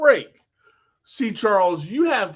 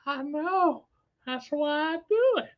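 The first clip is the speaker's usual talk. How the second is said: high, put-on creep voice; gleefully creepy, pitched way up; creepy funny voice; 9 semitones higher, 3 dB quieter than usual. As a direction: answer in a silly high creepy voice. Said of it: "that voice creeps me out".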